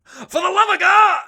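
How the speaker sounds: very high, hammed-up shout; intense mock begging. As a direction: cry it out as an overblown desperate plea. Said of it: "with that intensity".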